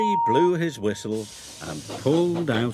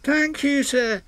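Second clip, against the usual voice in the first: high, louder happy thanks; 14 semitones higher, 3 dB louder than usual. A narrator's reading. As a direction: thank him beaming. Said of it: "said Henry happily".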